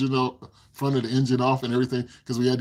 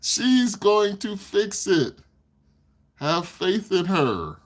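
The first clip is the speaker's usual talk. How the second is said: slow, higher-pitched, word-by-word insistence; emphatic reassurance; 8 semitones higher, much slower than usual. Real, not acted. Telling a doubter she will fix it, slow emphatic reassurance. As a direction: stretch out each word as firm encouragement.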